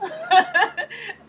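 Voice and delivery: high loud cackling laugh; real loud high laugh. Real, not acted. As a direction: burst out laughing. Laughing at the Portia moment.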